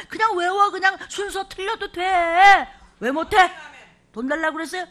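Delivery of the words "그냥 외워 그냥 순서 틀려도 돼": loud urging. Real, not acted. Urging the congregation loudly.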